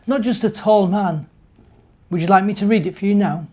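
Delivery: ordinary delivery, nothing marked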